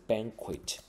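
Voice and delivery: banquet stressed on "ban", ending in a T; pronounced final T. Shown as a demonstration. Teacher contrasts two words spelled alike, final T pronounced. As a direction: sound the T closing banquet, stress up front. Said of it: "但发音不一样".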